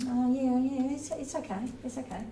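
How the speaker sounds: politely unimpressed, trailing off; polite lukewarm. Pretend. Tasting warm tap water, politely fading off. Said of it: "You go".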